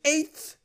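"eighth" said in an odd, exaggerated way; weird pronunciation. Real, not acted. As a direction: make "eighth" come out strangely overdone. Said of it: "said eighth in that weird way".

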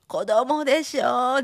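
loud, slow scold. Retold or imitated. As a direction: drag it out, scolding loudly.

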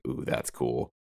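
low, very soft aside of approval; retold hushed awe. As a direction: murmur it low, as if quietly impressed. Retelling own quiet awed reaction.

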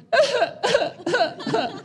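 high-pitched mock sobs; imitated toddler crying. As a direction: wail in bursts like a crying two-year-old, played for laughs. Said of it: "she would cry".

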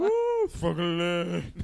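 put-on loud, high, slow drunken whoop; fake drunk voice. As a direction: drag it out like a sloppy drunk. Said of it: "fake drunk voice that I use".

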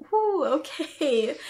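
slow, high, breathless whew of relief; stunned relief. Retold or imitated. Telling the Lord she's overwhelmed, slow stunned whew. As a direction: let it out like the weight just hit you.

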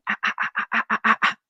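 quick hushed monkey panting calls; spider monkey call. Shown as a demonstration. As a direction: mimic a spider monkey greeting call with the mouth open. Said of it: "eles abrem a boca e fazem".

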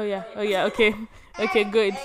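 usual speaking voice

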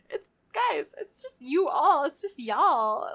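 protesting. Retold or imitated. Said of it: "And I was like".

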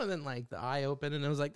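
ordinary talk, nothing marked

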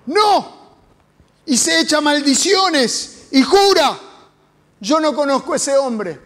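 loud, swearing he never knew him; cursing denial. Acted. Peter swears and curses at the servant girl, angry and loud. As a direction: spit out the denial with an oath.

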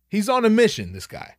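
ordinary talk

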